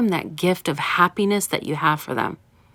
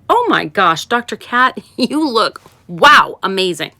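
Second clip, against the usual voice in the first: gushing praise; 3 semitones higher, 5 dB louder than usual. Retold or imitated. Nine more people gushing compliments.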